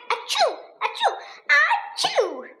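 high, rising sneezes; repeated sneezes. A narrator's reading. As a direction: sneeze over and over. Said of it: "he sneezes again and again".

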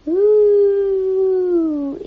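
long, loud high whoo; eerie monster howl. A narrator's reading. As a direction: hoot like a monster echoing from a crater. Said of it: "even louder now".

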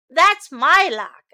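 loud, indignant claim; a skunk defending its log; skunk protesting. Acted.

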